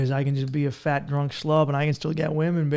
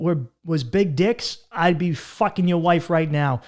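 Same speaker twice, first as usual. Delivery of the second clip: ordinary talk, nothing marked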